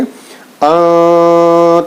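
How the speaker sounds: held nasal hum on अं, nasalised with the tongue off the palate; nasal hidden n. Shown as a demonstration. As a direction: sound अं through the nose, no clear n. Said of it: "ता इतना छुपाकर आपको पढ़ना".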